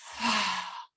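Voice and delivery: long, audible sigh out through the mouth; exhaling sigh. Shown as a demonstration. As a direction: let the breath whoosh out as a demonstration. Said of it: "and out through the mouth".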